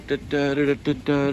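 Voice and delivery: ordinary talk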